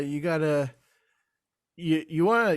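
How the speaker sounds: usual speaking voice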